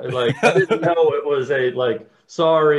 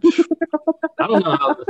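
higher, slow and stretched, a put-on voice; drawn-out mock voice; 13 semitones higher, 4 dB louder, slower than usual. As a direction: stretch the words out, pitched up. Repeated line, drawn out.

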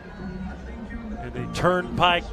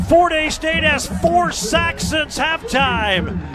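big play call, much higher and louder; excited shout; 10 semitones higher, 3 dB louder than usual. Real, not acted. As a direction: yell the sack call.

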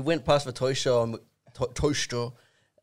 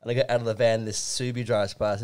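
usual speaking voice

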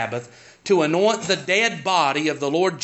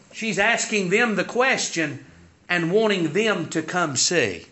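usual speaking voice

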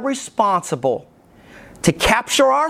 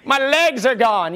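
imagined crying out; 4 semitones higher than usual. Retold or imitated. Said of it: "they're crying out".

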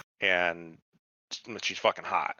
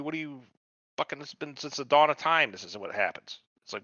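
ordinary delivery

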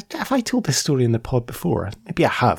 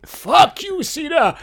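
high yelled insult, breaking into laughter; retold shout; 4 dB louder, slower than usual. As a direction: bellow it like a furious fan. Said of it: "just the way".

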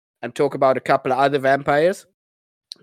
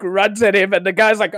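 ordinary delivery

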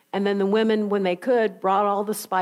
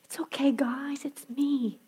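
mock tender whisper; 3 semitones higher, 7 dB quieter, slower than usual. Mock version of Jesus.

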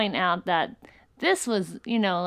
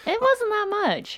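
squeaky defensive; 13 semitones higher, slower than usual. Real, not acted. Defending her ticket.

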